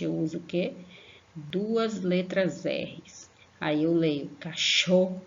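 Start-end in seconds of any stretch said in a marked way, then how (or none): 4.4-5.2 "cachorro" with a forceful h-like RR; "cachorro" broken up, heavy aspiration on "rro"; strong R. Shown as a demonstration. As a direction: stretch "cachorro" and push air into the last syllable. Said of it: "que o som fique forte".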